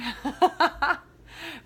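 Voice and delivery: giddy high laugh at the sign-off; bright high laugh. Real, not acted. Host laughing as she closes the podcast episode, giddy laugh. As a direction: let a happy laugh burst out. Hosts closing the show.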